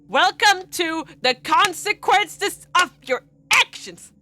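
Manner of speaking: high, slow, loud booming declaration; dramatic proclamation. Acted. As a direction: proclaim it like a doomsday herald.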